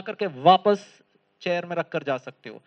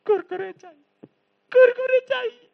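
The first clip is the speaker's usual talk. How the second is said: higher and louder each time, whining; escalating child whine; 7 dB louder than usual. Retold or imitated. Small boy keeps nagging mother for snacks. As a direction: build the demand into a wail.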